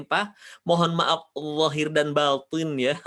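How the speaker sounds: slow, with zahir made heavy; joking fluent apology; mock fluent greeting. Shown as a demonstration. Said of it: "minta maafnya gini".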